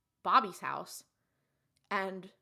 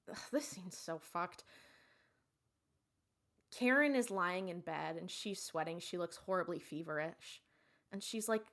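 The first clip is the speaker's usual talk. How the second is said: ordinary talk, nothing marked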